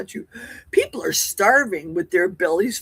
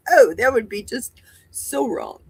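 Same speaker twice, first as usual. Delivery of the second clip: usual speaking voice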